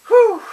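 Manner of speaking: big high exhale of relief; loud relieved whew. Real, not acted. Relief after risky tangent.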